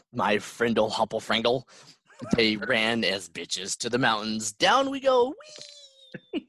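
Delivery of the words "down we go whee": excited. Acted. Descending to planet.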